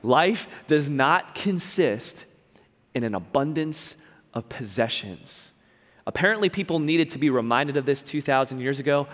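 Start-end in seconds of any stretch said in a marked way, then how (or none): 0.0-5.5 slow and deliberate. Shown as a demonstration. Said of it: "You should write it down".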